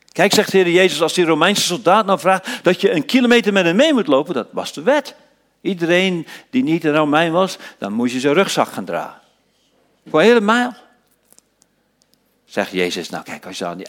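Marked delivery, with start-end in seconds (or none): 0.1-10.7 higher and louder, driving sermon voice; fiery preaching. Acted. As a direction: preach it out with fire. Preaching at full force. (vurig preken, hoger en luider)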